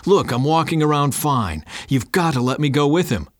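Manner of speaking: high, insistent pleading; protesting. Acted. Father insists he ride with son, protesting. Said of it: "I protested".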